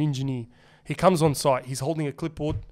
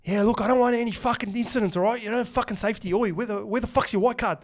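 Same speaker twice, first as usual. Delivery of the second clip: mock bossy site foreman talking down to workers; a hint of a gruff, looking-down-on-you foreman; lightly voiced foreman barking safety orders; condescending foreman; 5 semitones higher, 3 dB quieter than usual. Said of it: "The way he was like".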